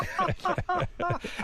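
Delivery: a high squealing laugh breaks the sentence; high burst of laughter. Real, not acted. Guest laughing at his own bodega story, high burst of laughter. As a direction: cracks up mid-story, high and breathless.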